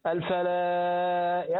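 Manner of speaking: الفلاح slow, holding the final ح until it comes out; drawn-out haa. Shown as a demonstration. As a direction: stretch الفلاح and let the ح ring. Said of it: "استني الحاء تطلع". (مدّ الفلاح حتى تظهر الحاء)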